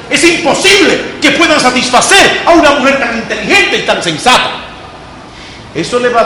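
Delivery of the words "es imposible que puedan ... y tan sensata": high, loud, indignant demo; quarrelling. Shown as a demonstration. Teacher shows class how to read it peleando, loud mock quarrel. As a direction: read it as if picking a fight. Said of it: "Díganlo peleando".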